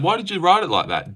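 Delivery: normal talking voice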